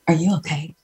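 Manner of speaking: soft, caring counselor tone; therapist voice. Retold or imitated. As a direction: ask like a concerned therapist. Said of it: "you use the therapist voice".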